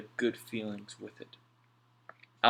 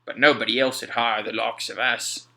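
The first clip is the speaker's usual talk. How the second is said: louder, rueful sailor voice; Mac, rueful sailor; 8 dB louder than usual. A narrator's reading. Mac to Alf about being unhirable, rueful voice. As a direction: admit the hard truth.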